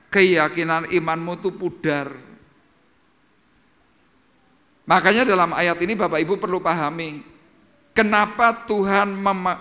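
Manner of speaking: high, loud pulpit exhortation; fervent sermon voice; preaching at full force. Acted. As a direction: drive the point home to the congregation. Preacher exhorting congregation loudly.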